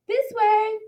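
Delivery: high calling out. A narrator's reading. Animals calling Kiko on through the woods, a high eager call. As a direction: call them along the path. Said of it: "the animals called".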